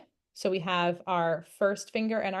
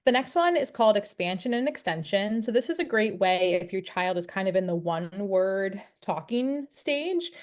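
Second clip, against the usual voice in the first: ordinary delivery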